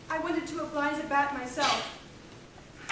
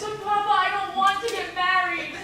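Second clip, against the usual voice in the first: desperate plea; 3 semitones higher, 6 dB louder than usual. Acted. Bride pleading with father.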